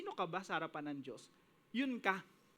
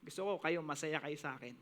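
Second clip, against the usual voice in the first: quiet devoted prayer; 6 semitones lower, 4 dB quieter than usual. Retold or imitated. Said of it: "Nabubuhay ka parang".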